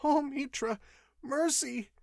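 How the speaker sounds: high, terrified plea; mild mock scream. A narrator's reading. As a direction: beg for mercy in a high voice. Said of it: "screamed the wretch".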